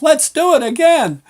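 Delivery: put-on high, loud child yelling with glee; excited little boy shout. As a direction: shout it like a thrilled four-year-old. Little boy yelling his head off.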